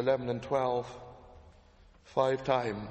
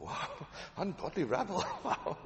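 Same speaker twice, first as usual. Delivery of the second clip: laughing; 4 semitones higher, 6 dB quieter than usual. Real, not acted. Amused reaction to quote.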